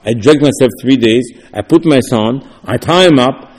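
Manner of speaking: ordinary talk, nothing marked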